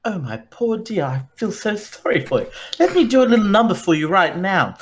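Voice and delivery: high, theatrical sympathy; mock pity. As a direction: gush over her like a showman. Bard pities old guardian.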